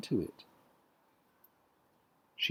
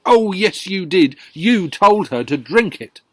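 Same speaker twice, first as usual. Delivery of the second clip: accusing wife; 4 semitones higher, 11 dB louder than usual. Acted.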